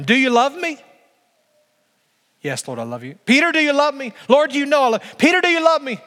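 high, loud, urgent asking; a preacher's soaring dialogue; impassioned repeated question. Pretend. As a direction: press the question harder each time. Reenacted questioning, rising intensity.

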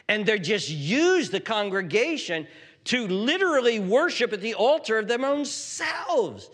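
high, loud, driving sermon delivery; full-force preaching. Real, not acted. Preacher condemns politicking church officers, preaching at full force. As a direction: thunder against the powerful from the pulpit.

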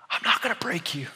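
a hushed, gentle promise; tender whisper. Acted. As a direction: whisper it like comfort. Voicing God's tender promise.

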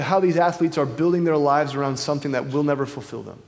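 ordinary delivery

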